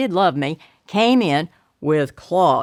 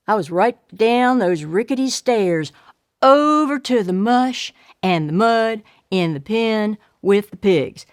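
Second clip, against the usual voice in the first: plain reading voice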